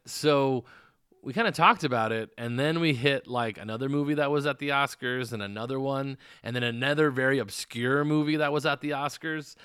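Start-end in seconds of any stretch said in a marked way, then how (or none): none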